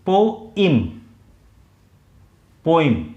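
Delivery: पोइम said as "po-im", two syllables, short i; pronunciation demo. Shown as a demonstration. Teacher showing the right way to say poem, "po-im". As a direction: model पोइम clearly for students, poh-im. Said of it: "इसका pronunciation होता है".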